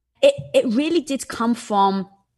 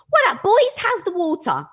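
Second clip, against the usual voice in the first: big fish greeting; 9 semitones higher than usual. Retold or imitated. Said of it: "the big fish goes to the little thing".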